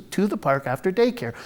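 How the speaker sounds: ordinary talk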